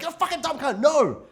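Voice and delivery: high, loud mock outburst at a friend; mock outraged yell. Answering a friend's silly film take with a mock high yell. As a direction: pretend to blow up at a dumb question. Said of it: "I'm like".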